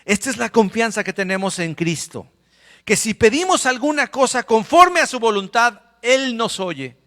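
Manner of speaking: loud, high preacher's proclamation; preaching at full force. Acted. Preacher reads scripture aloud to the congregation, loud and high. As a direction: proclaim the verse to the church.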